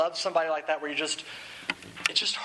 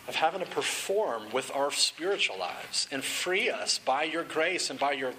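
lightly lowered, earnest prayer voice; closing prayer; 3 semitones lower than usual. Acted. Pastor praying over the room, lightly hushed.

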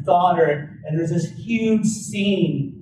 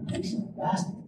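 stunned whisper; 3 semitones higher, 12 dB quieter, faster than usual. Retold or imitated. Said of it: "I said".

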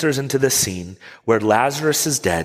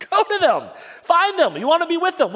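urgent; faster than usual. Acted. Urging listeners to rush.

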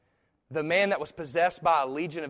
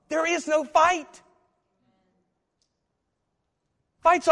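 much higher, louder proclamation; full-force preaching; 12 semitones higher, 7 dB louder than usual. Acted. Preacher declaring God's victory, loud and high. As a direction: proclaim it to the back row.

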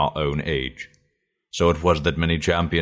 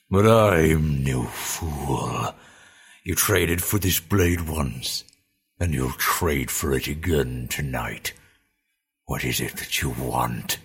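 slow, lower, openly distrustful; suspicious orc; 4 semitones lower, slower than usual. A narrator's reading. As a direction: let the suspicion show. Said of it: "not masking his suspicion".

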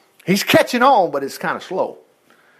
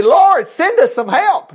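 louder, higher, drawn-out plea to God; mock pleading prayer; 10 semitones higher, 5 dB louder, slower than usual. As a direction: slowly cry out a pretend prayer for help. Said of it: "Notice he didn't say".

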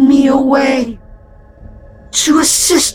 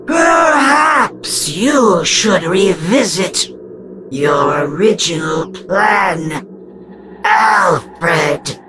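slow, high, softer menacing ghost voice; dripping with menace; 9 semitones higher, 4 dB quieter, much slower than usual. A narrator's reading. Ghost goading Alf about his old plan, menacing. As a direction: taunt him toward the rope. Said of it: "her voice dripping with menace".

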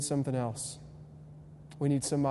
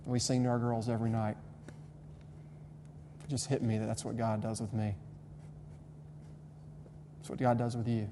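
softer, holding back tears; fighting a lump in the throat; choked up; 4 semitones lower, 9 dB quieter than usual. Real, not acted. Preacher tearing up thinking of singing to his girls, choked up. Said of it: "Sorry, getting choked up".

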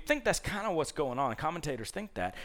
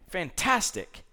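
ordinary talk, nothing marked

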